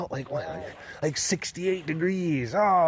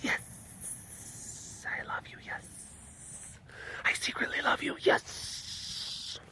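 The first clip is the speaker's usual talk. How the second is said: whisper; 12 dB quieter, slower than usual. Pretend. Voicing tiger's secret love.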